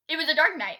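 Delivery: a hint of spooky narrator; spooky storyteller. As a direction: open like a campfire ghost tale, half-joking. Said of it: "Why do I sound like this?".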